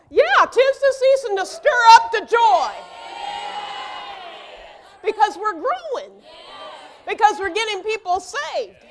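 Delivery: loud, high, preaching at full force; fiery pulpit shout. Real, not acted. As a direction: rouse the church with a shout. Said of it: "But why am I shouting".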